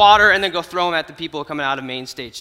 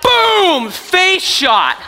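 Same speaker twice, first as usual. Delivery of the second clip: much higher and louder, an explosive Boom; triumphant boom; 10 semitones higher, 6 dB louder, much slower than usual. Retold or imitated. Telling the crowd of the hit, a triumphant boom. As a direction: shout it like a hit landing. Balloon hits through sunroof.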